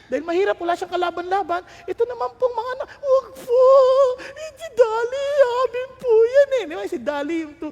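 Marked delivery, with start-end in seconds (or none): 2.9-6.6 high, loud tearful begging; a child sobbing, begging them not to take it; crying child's plea. Retold or imitated. Poor children pleading for lamb. (umiiyak na pagmamakaawa ng bata)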